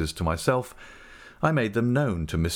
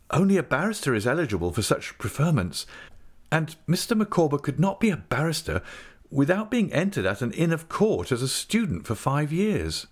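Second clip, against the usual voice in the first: higher-pitched, deliberate explaining; Traddles, explanatory; 8 semitones higher than usual. A narrator's reading. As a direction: spell out the legal rules point by point.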